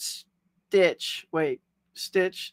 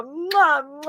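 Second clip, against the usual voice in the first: blown kisses; 8 semitones higher than usual. Real, not acted. Blowing kisses to sister.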